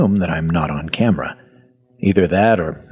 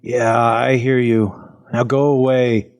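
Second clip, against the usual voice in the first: higher, slow, put-upon reply; irritated dismissal; 5 semitones higher than usual. Acted. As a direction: wave off an unwelcome caller, drawing it out. Groggy man dismisses intruding voice.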